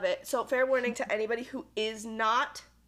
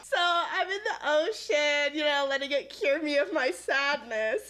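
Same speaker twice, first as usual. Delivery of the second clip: higher, slow laughing storytelling; 3 semitones higher than usual. Real, not acted. Telling her friend about grieving, laughing. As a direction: laugh through the sad part.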